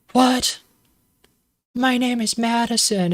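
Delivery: a hammed-up startled cry at the wrong name; mock surprise. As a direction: squeak it out as a shocked young woman. Madison reacts to the wrong name.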